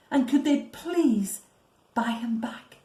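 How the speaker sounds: usual speaking voice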